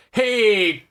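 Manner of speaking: hammed-up New York accent yelling; New York shout. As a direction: yell it like a cabbie cut off in traffic. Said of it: "I'll do it for you".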